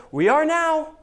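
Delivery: high, loud, proud punchline; triumphant reply. Retold or imitated. Ruth answering her mother-in-law, smugly triumphant. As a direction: land it like a winning comeback. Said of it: "Ruth looks at her mother-in-law and goes".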